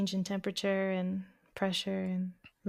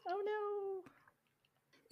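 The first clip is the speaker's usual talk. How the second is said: mock dismay; 10 semitones higher than usual.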